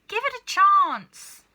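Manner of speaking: very high, loud slow shout; loud cry. A narrator's reading. Mister Cranky shouting at his wife, very loud high cry. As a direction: bellow it in frustration. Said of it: "cried Mister Cranky".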